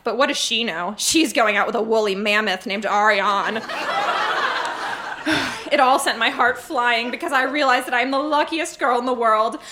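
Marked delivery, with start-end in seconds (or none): 3.7-5.7 long lovestruck sigh; a wistful happy exhale; dreamy sigh. Acted. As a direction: sigh like a girl in love. Dreamy sigh after mammoth joke.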